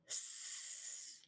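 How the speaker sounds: long hissing unvoiced s like a snake; drawn-out s like a snake. Shown as a demonstration. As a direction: hiss the s like a snake. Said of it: "like a snake".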